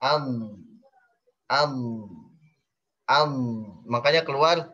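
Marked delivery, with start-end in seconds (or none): 0.0-3.3 "Am" held with the tongue stretched long; istitolah dod. Shown as a demonstration. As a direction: drag the tongue along on "am". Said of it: "huruf dod memanjang lidahnya".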